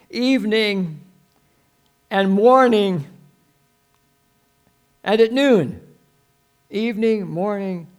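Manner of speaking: loud, high, word-by-word proclamation; declaimed scripture. Acted. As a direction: ring out each time of day. Declaiming the verse at volume.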